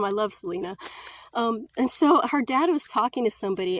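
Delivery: ordinary delivery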